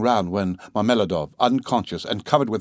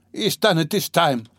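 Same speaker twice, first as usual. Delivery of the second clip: high, desperate outcry; despairing cry; 6 semitones higher than usual. Acted. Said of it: "Katerina Ivanova cried despairingly".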